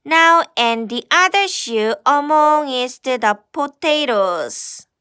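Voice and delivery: usual speaking voice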